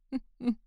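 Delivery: high, mocking laugh at him; scornful laugh. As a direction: laugh down at his pathetic plea. Laughing at his begging.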